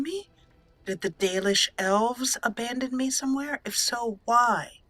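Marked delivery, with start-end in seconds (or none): none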